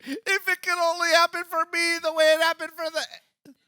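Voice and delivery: high, loud yearning wish; hopeful, swooning sigh of a wish; wistful longing. Retold or imitated. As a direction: plead like someone dreaming of a movie ending. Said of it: "You were like".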